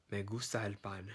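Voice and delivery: "Me gusta el pan" in a careful Spanish voice; Spanish example sentence. Shown as a demonstration. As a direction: model the sentence for learners. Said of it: "I'm gonna put it in context".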